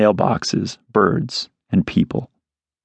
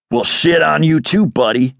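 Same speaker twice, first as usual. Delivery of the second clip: higher, angry cursing at a bird; 8 semitones higher than usual. Acted. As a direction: spit the curse out. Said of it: "he cursed".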